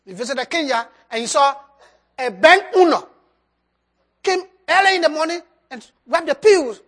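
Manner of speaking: normal talking voice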